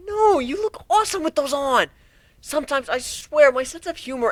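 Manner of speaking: put-on high, excited young colt; a bright kid pony, awed then teasing; young pegasus voice. Narrator voices Whiplash.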